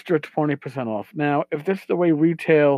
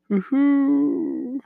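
mock cheer; 10 semitones higher than usual.

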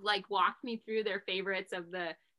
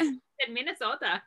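put-on slow, drawn-out playful Minnesota accent, higher and a bit louder; 4 semitones higher, 4 dB louder than usual. Host teasing her Minnesota-born guest, in a mock Minnesota accent. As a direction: tease it out in a stretched Minnesota accent. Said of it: "I dropped the Minnesota accent".

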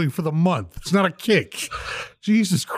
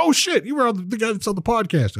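much higher, excited recognition; surprised doctor; 10 semitones higher than usual. Retold or imitated. Doctor recognising the podcast guy, high excited voice. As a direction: squeak it out like a starstruck fan. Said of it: "He's like".

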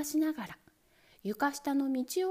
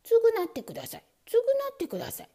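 higher, louder, fast insistent plea; shrill demand; 12 semitones higher, 6 dB louder, faster than usual. Acted. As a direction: nag in a rising squeal.

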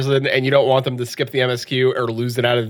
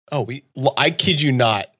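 normal talking voice

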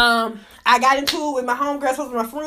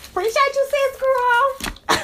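high-pitched thanks; 11 semitones higher, much slower than usual. Real, not acted. Thanking viewer, high squeal.

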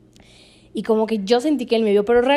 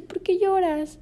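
high-pitched, tender imitation of an uncle; 9 semitones higher, slower than usual. Uncle asks his crying niece why, tenderly imitated. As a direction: ask it like a doting uncle. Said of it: "Pero así súper tierno".

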